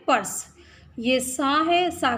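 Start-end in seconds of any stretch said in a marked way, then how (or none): 0.1-0.5 पर्स in one syllable, र straight into स; पर्स said as purs; correct reading. Shown as a demonstration. Teacher answering her own question, correct joined cluster. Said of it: "कैसे बोलेंगे?".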